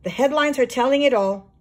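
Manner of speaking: usual speaking voice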